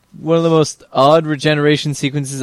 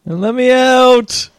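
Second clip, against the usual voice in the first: shouting; 10 semitones higher, 4 dB louder, much slower than usual. Pretend. Voicing trapped character.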